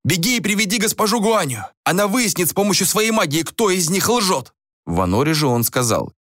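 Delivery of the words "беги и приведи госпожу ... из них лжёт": high-pitched, urgent instruction; acted innkeeper giving orders; raised-voice order. A narrator's reading. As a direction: send your son running. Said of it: "приказал одному из сыновей".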